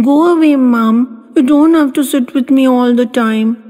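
put-on high, tender little girl's voice pushing her mother away gently; sweet, loving child. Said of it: "in a sweet, loving way".

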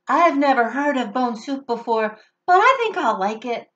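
a small creature hopeful for soup; eager little werewolf. Acted.

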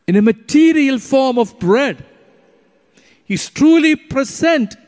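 high, loud preaching climax; fervent preaching. Acted. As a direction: proclaim it to the church.

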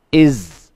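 "is" said as iz, with a buzzing z; IZ ending sound. Shown as a demonstration. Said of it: "nó được phát âm thành ba âm".

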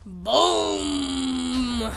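long, booming "boum"; drawn-out vocal explosion; mimicked explosion. Real, not acted. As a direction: stretch the boom out with the blast. Voices the explosion on impact.

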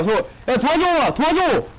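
high, loud desperate calls for help; shouting for help. Real, not acted. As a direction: scream to your teammates to help you. Calling teammates for help. (팀원에게 도와달라고 높게 외침)

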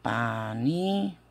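syllables split by long pauses; slow pronunciation demo. Shown as a demonstration. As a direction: sound it out for students, stretching the last word. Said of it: "esta pronunciación es así". (pronunciación lenta, separando sílabas)

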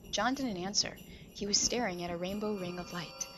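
ordinary delivery, nothing marked

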